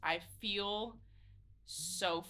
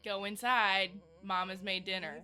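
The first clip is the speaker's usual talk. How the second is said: louder, insistent big-sister order; firm repeat; 10 dB louder than usual. Acted. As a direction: press a reluctant child to obey, louder. Repeats order after reluctant Mm.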